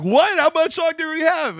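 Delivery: high, loud panicked outburst; hammed-up cry of alarm; mock alarm. Retold alarmed reaction to bad news.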